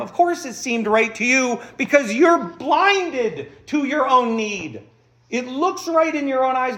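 slow, loud, emphatic rebuke; righteous anger. Real, not acted. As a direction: press the point home firmly. Preacher rebukes the excuse.

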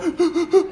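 high imitated sob gasp; sobbing gasp. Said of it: "The kind of crying that makes you go".

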